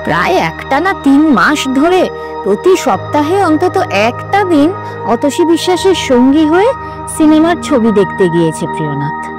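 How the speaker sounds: ordinary delivery, nothing marked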